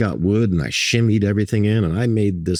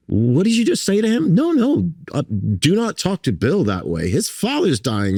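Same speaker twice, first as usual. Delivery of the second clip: retold indignant defence; 12 semitones higher than usual. Dudley Moore rebuking the designer for Bill, mock indignant. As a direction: act out leaping to someone's defence.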